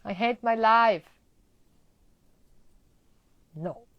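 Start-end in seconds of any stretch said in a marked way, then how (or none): 0.0-1.1 mock dread. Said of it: "you're like".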